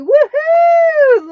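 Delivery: big high whooping cheer. Real, not acted. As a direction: whoop it out for the winner. Cheering a giveaway winner.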